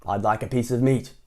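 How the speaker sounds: usual speaking voice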